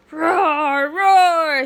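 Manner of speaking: put-on high, very loud toddler roar; high, loud mock roar; baby roaring. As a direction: roar like a plane, playfully. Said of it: "says Honey".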